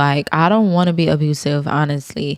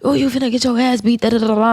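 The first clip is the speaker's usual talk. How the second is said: higher, hammed-up scolding mom; mock threatening parent; 6 semitones higher, faster than usual. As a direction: play the mom who threatens a whooping, half joking. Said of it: "I'm not about to".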